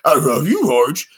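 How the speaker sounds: talking dog voice, swooping up; Astro. Shown as a demonstration. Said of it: "He's a little bit higher pitched".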